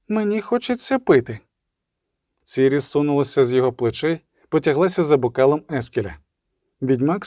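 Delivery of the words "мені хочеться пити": put-on high-pitched little girl voice; child's plea. A narrator's reading. Ciri asks the adults for a drink, childlike. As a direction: lift it high like a child asking.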